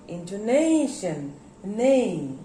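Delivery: INTONATION with NAY lifted and stretched, then NAY alone; stress on NAY. Shown as a demonstration. Teacher pointing out the stressed syllable, emphatic. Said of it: "This syllable has got the stress".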